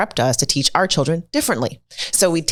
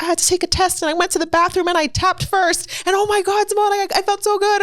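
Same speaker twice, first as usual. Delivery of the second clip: put-on much higher, gushing kid voice; excited kid. A student telling Simone about tapping before a test, high and gushing. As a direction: gush like a thrilled child. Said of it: "I've got kids like".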